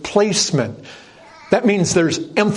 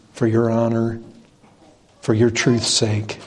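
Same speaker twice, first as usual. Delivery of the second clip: prayer; 5 semitones lower than usual. Real, not acted. Pastor leads a closing prayer.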